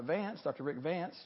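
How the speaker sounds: usual speaking voice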